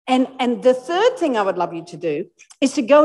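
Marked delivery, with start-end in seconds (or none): none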